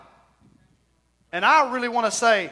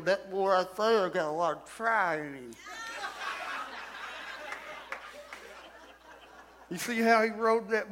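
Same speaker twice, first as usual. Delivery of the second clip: lip full of dip; 8 dB quieter than usual. Pretend. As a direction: talk like a cowboy with chewing tobacco in. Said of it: "I'm speaking with a dip in my mouth".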